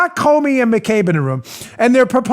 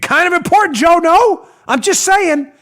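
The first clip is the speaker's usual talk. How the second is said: higher-pitched rhetorical needling; sarcastic incredulity; 8 semitones higher than usual. Real, not acted. Host needles co-host Joe about missing evidence, sarcastic. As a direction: pitch it up, feigning an innocent question.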